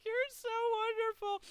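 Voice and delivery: very high, plaintive thanks; overcome gratitude. Retold or imitated. Guest thanking front desk lady for food, high emotional gratitude. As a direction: squeak it out, moved by kindness.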